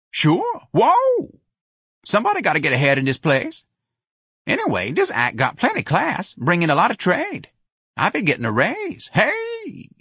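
high, lively patter with whoops; exuberant Jones character. A narrator's reading. Jones talking up the act to Lana, exuberant. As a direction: crow about it in a high voice.

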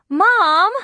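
very high, loud anguished call; crying out. A narrator's reading. Girl cries out to her mother in protest. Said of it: "I cried".

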